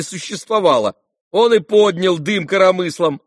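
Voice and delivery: high, loud, jeering protest; mocking complaint. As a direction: keep pleading innocence with mockery. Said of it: "тем же насмешливым тоном".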